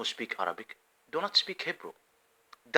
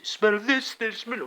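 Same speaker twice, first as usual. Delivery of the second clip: mock quote; 11 semitones higher, 5 dB louder, slower than usual. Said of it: "they say".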